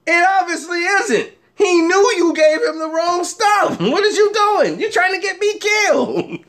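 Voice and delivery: high, agitated mock scolding; retold quarrel. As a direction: chew out a buddy in comic alarm. Acted quarrel with buddy over mix-up.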